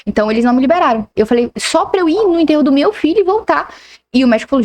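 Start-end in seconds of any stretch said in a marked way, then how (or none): 1.6-3.7 higher pleading voice; desperate plea. Retold or imitated. Mother begging the doctor to let her attend the burial, higher pleading voice.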